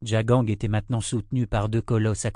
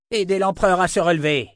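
higher, louder shouted command; shouting order; 8 semitones higher, 5 dB louder than usual. A narrator's reading. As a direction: bark it across the battlefield. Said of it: "cria-t-il".

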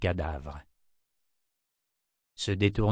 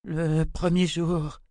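high, slow dazed woman's voice; eerie high-pitched delirium; deranged babbling; 8 semitones higher, much slower than usual. A narrator's reading. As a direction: utter it high and drawn out, as if entranced. Deranged sister babbles incoherently.

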